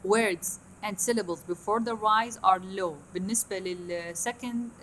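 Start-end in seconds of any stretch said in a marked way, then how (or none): 0.1-2.9 slow, deliberate reading of the rule; slow reading aloud. Shown as a demonstration. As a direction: dictate the rule slowly to the class. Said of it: "هنا أنا هسه قايلها".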